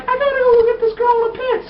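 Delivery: very high falsetto imitation; put-on girl voice. Girl heads off to a pit rescue, mock urgent.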